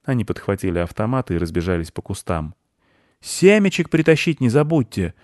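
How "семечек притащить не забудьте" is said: high-pitched parting call; mild squirrel shout; calling after them. A narrator's reading. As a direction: call out to friends running away. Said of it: "крикнула им вслед белка".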